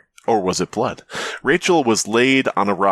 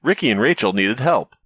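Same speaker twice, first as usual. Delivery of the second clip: ordinary delivery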